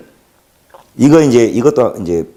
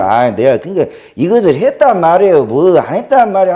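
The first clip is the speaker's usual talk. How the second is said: higher-pitched, tipsy-sounding Jeolla accent imitation; mock Jeolla dialect; 6 semitones higher than usual. Mimicking an elite ex-prosecutor politician's testimony, drunkard-like Jeolla accent. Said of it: "완전히 뭐 술주정뱅이 그런 톤이 있어요".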